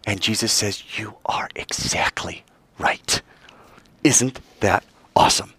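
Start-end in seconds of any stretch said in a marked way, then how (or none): 1.0-3.2 slow, whispered affirmation; soft whisper. Acted. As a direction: answer her in a gentle whisper. Jesus answers her softly.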